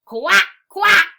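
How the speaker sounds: high, very loud quacks; loud duck quack. Acted. Teacher reads the noisy duck to children, loud high quacking. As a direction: quack it out at full volume. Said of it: "went the duck".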